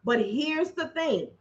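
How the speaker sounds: ordinary talk, nothing marked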